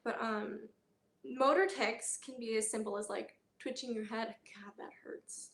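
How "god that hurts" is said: whispered in pain. Real, not acted. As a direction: breathe it out, hurting. Pain whisper after a tic.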